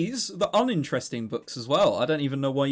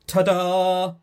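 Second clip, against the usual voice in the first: mock ta-da; 3 semitones higher, 7 dB louder than usual. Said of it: "He's going like".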